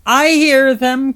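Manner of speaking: high, slow, drawn-out shout; loud cry. A narrator's reading. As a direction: cry out the news at full volume. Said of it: "cried the head".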